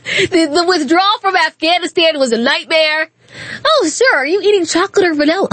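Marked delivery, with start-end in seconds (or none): 3.6-5.5 mocking fawning reporter. Mocking reporters' soft questions to Biden.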